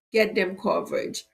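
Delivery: usual speaking voice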